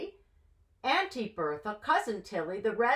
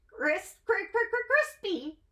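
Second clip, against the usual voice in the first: high hen clucking, stuttered; 11 semitones higher than usual. Acted. Red hen pecking matzah, clucking "crispy" high.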